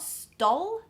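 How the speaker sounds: "stole" with a short O, like "stoll". Shown as a demonstration. Teacher showing learners the wrong stole, short O. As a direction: say "stole" with the vowel of "doll". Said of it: "as in a short O sound".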